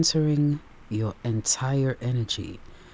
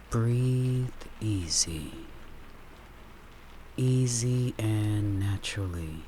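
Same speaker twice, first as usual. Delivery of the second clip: guided meditation; 2 semitones lower, 5 dB quieter than usual. Acted. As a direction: ease the listener into calm, word by word.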